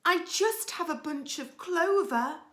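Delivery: high, small rabbit voice; high, meek little rabbit; timid rabbit. Acted. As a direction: squeak it out like a nervous little rabbit.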